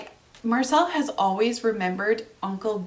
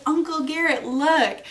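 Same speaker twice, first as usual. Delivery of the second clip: put-on a light, higher little-girl voice; hint of a small child showing off a gift; toddler voice; 7 semitones higher, 3 dB louder than usual. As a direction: lightly play the excited toddler. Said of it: "she was like".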